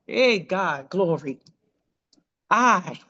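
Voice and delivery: usual speaking voice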